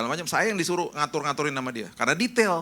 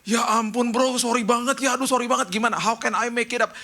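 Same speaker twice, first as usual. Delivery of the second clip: guilty apology; 6 semitones higher than usual. Retold or imitated. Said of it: "bisa rasa bersalah saya".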